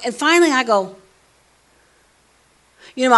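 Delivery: ordinary delivery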